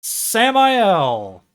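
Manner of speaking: high, loud blurted name; hammed-up awkward introduction; improvised lie. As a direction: blurt it like a name just invented. Made-up name blurted in retelling.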